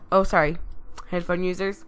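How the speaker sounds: ordinary talk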